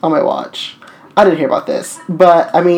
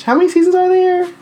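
much higher, incredulous repeat of the question; mock shocked echo; 13 semitones higher than usual. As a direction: throw the question back in disbelief. Wait a fucking minute.

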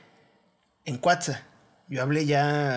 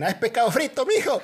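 mock Veracruz accent; 8 semitones higher than usual. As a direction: squeal it like a jarocha fish seller. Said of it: "Así te hablaba".

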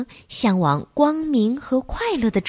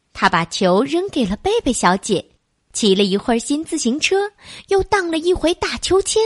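usual speaking voice

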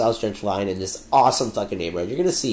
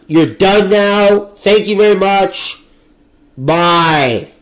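mocking farewell; 6 semitones higher, 7 dB louder, much slower than usual. Real, not acted. Dismissing the boomers.

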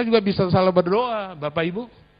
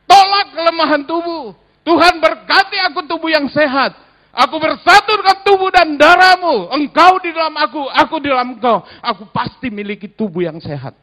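higher, louder confident faith declaration; declaration prayer; 11 semitones higher, 7 dB louder than usual. Shown as a demonstration.

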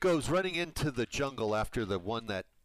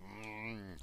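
normal talking voice

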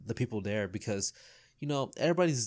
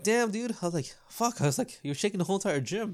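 impressed shout; 8 semitones higher than usual. Retold or imitated. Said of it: "I'm like".

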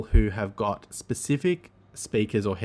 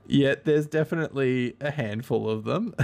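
laughing through the words; amused, breaking up with laughter; 4 semitones higher than usual. Real, not acted. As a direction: let real laughter break into the phrase. Amused by search results.

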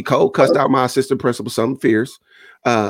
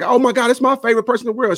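delighted greeting; 8 semitones higher than usual. Retold or imitated. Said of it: "I come around like".

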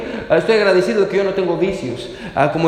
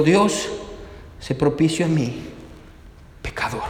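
low, hushed plea with long pauses; penitent prayer; 7 semitones lower, 7 dB quieter than usual. Acted. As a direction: sink lower and softer, like a humbled sinner praying. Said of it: "sino que se golpeaba el pecho". (oración penitente, grave y muy suave, con pausas)

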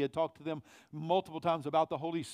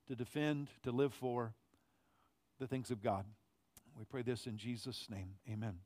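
hushed congregational prayer; 4 semitones lower, 4 dB quieter than usual. Acted. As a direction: pray it quietly, heads bowed. Pastor prays before sermon.

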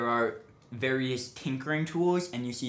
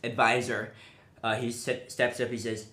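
usual speaking voice

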